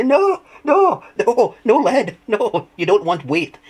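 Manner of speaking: high, loud, squealing through laughter; laughing protest. Retold or imitated. Telling John to drop the lead, helpless with laughter. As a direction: beg him to stop while cracking up. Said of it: "I'm killing myself laughing. I go".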